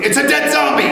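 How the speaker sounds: sudden high-pitched alarm; comic shriek of discovery; mock horrified outburst. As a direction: jump up in pitch as if shocked by the picture. Sudden horrific picture in slideshow.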